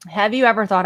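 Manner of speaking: ordinary talk, nothing marked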